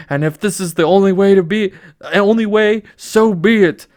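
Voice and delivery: loud, hammed-up angry resolve; father king raging at his daughter; King Triton vowing. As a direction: sea king losing his temper. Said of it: "King Triton".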